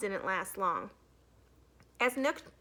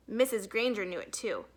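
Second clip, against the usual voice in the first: usual speaking voice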